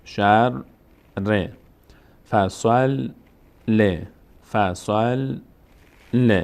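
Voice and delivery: شر، ر as "shar, re", فصل، ل as "fassal, le"; split syllables. Shown as a demonstration. Teacher drilling further examples, syllable by syllable. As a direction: clear gap before the second ر and ل.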